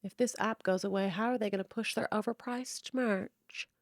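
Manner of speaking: whispered mock sympathy; mock pity. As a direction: sneer in a fake-pitying hush. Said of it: "be like".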